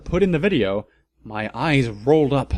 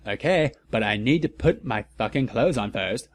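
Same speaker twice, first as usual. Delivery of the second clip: usual speaking voice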